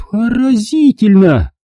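high, slow sarcastic. Acted. Mother folds her arms at Lauren, high slow sarcasm. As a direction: drip with irony. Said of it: "сказала мама".

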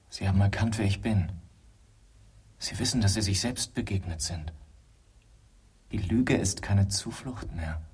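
ordinary delivery

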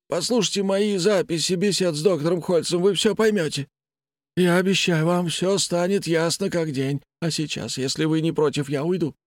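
a detached, unsettling patient; blind patient voice. Acted.

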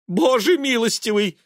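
high, loud appalled cry; horrified mother. A narrator's reading. Mother hearing son gave his skin, horrified. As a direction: exclaim in high-pitched dismay.

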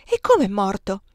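high, loud disbelieving questions; shocked exclamation. A narrator's reading. Hearing of the brother's death, shocked and loud.

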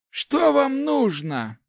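high, slow, strained demand; through clenched teeth. A narrator's reading. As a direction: force it out grudgingly through the teeth. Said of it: "процедил сквозь зубы старик". (процедить сквозь зубы, натужно)

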